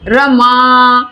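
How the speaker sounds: रमा with the final आ drawn out slowly; रमा held for three beats; stretched vowel. Shown as a demonstration. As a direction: pull the आ of रमा long. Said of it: "आ का स्वर खींचकर".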